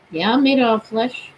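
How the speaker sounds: ordinary talk, nothing marked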